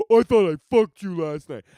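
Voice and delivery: loud gloating mock voice of the lot attendant; smug gate guy. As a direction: rub it in like a petty gatekeeper. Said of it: "the guy who had to lock that gate and be like".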